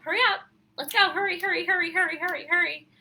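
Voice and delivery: imitated urgent hurrying. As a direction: mimic someone frantically rushing a child along. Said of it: "I was auditorily being told".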